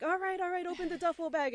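high, loud urgent commands; frantic, high urgency. Retold or imitated. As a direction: rush her in the frenzy. Frenzy pulling bird out.

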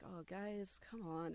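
soft, tender coaxing; soft pleading to the birds; soothing. Retold or imitated. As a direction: coax the scared birds gently.

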